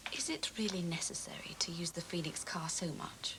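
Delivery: hushed, barely voiced whisper. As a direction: drop to a whisper and question him. Tense office argument over costs.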